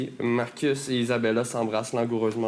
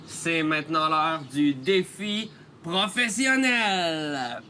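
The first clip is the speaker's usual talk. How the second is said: announcer voice; 3 semitones higher, much slower than usual. Acted. Game segment announcement.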